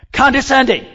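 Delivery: loud, high spat-out word; with hate. Shown as a demonstration. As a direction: spit it out with hate. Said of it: "Let's try it again".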